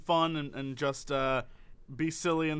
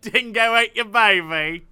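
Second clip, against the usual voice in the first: hammed-up Aussie, loud and drawn out; mangled mock-Australian holler; bad Australian accent; 4 semitones higher, 8 dB louder, slower than usual. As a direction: botch an Australian accent, higher and slow. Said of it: "That was some accent somewhere".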